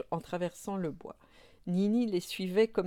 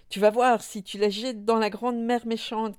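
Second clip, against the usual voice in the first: loud, raised threatening voice; angry cry; 4 semitones higher, 6 dB louder than usual. A narrator's reading. Nini threatening papa Moumine, crying out angrily. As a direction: shout the threat as a small furious child. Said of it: "criait-elle".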